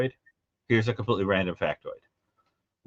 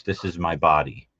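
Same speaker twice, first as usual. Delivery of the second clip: usual speaking voice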